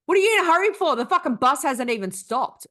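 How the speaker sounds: loud, high mock outburst from a rude stranger; hammed-up rude commuter shouting; angry yell. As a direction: bark it at him like he's offended. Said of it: "And he goes".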